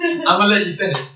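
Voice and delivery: fast, put-on Kanye voice; [name]. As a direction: repeat it in a fuller Kanye voice. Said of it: "[name]".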